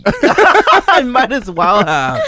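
laughing while answering; giggling through the words. Real, not acted.

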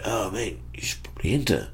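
whispered, soft nudge; hushed urging. Retold or imitated. Friends urging Otis to enter, soft whisper. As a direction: lean in and whisper the suggestion. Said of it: "Everyone's like".

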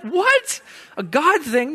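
high, rising disbelief; can't believe what you just heard; incredulous. Retold or imitated. Friend scoffing at a God thing, incredulous.

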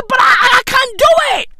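hammed-up panicked rant; mock frantic shout. Imitated heiress getting frantic.

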